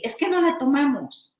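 "Es que no la tomamos" quoted high, falling on "la"; high quote. Retold or imitated. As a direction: echo Eri's words up high. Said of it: "nos decía Eri".

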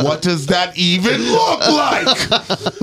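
can't believe what you just heard, yell it; stunned, laughing outcry; shocked disbelief. Retold or imitated. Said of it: "I was like".

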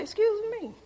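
high-pitched, taken-aback retort; indignant excuse me. Retold or imitated. Talking back to the Holy Spirit, high and indignant. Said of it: "that's how you talk to him".

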